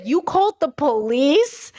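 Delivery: slow, drawn-out disbelieving question; stunned disbelief. Retold or imitated. Family confronts the youngest uncle, slow stunned disbelief. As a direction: drag it out in shock.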